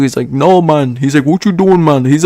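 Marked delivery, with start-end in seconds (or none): 0.3-1.0 lightly imitated Jamaican accent; a hint of a Jamaican friend's accent. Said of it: "I have a friend that's Jamaican". 1.3-2.1 put-on Jamaican accent, slightly raised. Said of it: "he always talks to me like that".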